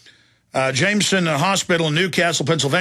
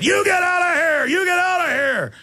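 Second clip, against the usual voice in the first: retold yell; 14 semitones higher than usual. Wife shouting to chase off a growling dog, high yell. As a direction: yell it sharply to scare the dog away. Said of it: "She screams at it".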